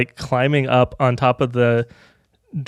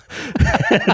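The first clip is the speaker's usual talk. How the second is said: high laughter; 10 semitones higher than usual. Real, not acted. Laughing at own story.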